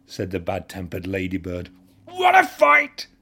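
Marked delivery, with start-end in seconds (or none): 2.1-3.1 challenging shout. A narrator's reading. Ladybird picks a fight.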